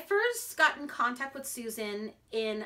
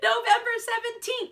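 excited announcement; 11 semitones higher, 7 dB louder than usual. Real, not acted. Announcing due date excitedly.

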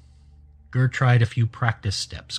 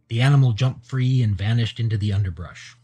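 plain reading voice

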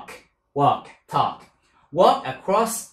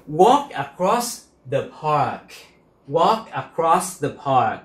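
"Walk across the park" spoken slowly and evenly; slow model sentence; slower than usual. Shown as a demonstration. Teacher modelling a sentence for the class, slow and careful. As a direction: lay out each word slowly for learners. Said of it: "teacher model sentence".

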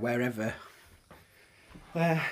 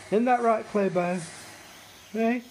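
higher, louder sing-song pet voice; talking to the dog; 8 semitones higher, 4 dB louder than usual. Real, not acted. Dad asks the dog Clobo, cooing pet voice.

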